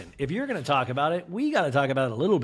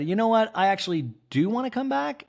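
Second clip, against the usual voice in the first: high mock voice; 8 semitones higher than usual. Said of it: "She also then said".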